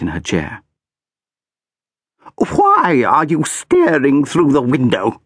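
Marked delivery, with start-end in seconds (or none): 2.4-5.2 high, slow, cold aristocratic voice; haughty. A narrator's reading. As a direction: look down your nose at a child. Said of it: "asked a haughty voice".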